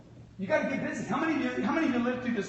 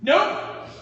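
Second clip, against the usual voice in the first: curt refusal; 7 semitones higher, 7 dB louder than usual. Retold or imitated. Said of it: "And the guy's like".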